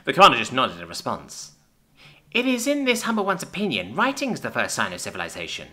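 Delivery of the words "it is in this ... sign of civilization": much higher, humble little creature; small mouse offering its view modestly; squeaky mouse voice. Acted. Mouse-like sec speaks.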